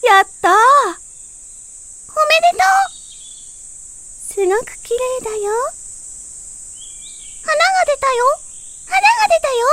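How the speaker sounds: very high loud delighted cheers; joyful celebration. A narrator's reading. Friends celebrating the bloom, very high loud joyful cries. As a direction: burst with joy at the flower.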